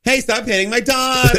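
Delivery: high, hammed-up cry of protest; protesting father. Host as wrestler father defending his daughter, high mock yell. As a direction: play a comic dad yelling from the ring.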